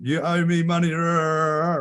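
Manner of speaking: high-pitched mock ranting; cartoonish high rant trailing into noise; imitated angry guy.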